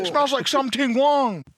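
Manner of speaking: high, slow, loud put-on accent; hammed-up broken English, drawn out; mock accent. Coworker imitating a nurse in a high put-on accent. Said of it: "he's like".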